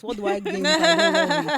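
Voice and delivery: loud, high, amused protest; laughing rhetorical question. Real, not acted. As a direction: burst out laughing as you ask.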